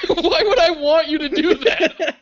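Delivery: loud, high, drawn-out incredulous retort; laughing disbelief. Retold or imitated. Replying to friend who thought I wanted it, laughing outrage. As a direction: stretch the question out through laughter.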